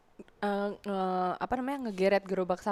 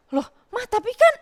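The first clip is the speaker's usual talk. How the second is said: whiny protest; 14 semitones higher, 8 dB louder than usual. Retold or imitated. Child protesting.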